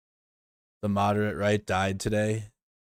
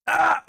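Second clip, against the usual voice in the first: short high-pitched yelp; brief squealed "ah"; sudden yelp; 5 dB louder than usual. Real, not acted. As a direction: let out a quick, loud high cry. Sudden yelp mid-stream.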